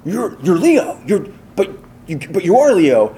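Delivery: high, loud mock gush over meeting Leo; hammed-up excited colleague; excited fan. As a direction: imitate a friend's giddy realization. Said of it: "was like".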